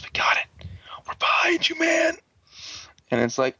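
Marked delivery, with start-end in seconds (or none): 0.0-2.2 whispered, then strained and very high; creepy mock encouragement. Red backs Ian's revenge, creepy high-voiced support. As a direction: start in a whisper, leap to a squeaky high register.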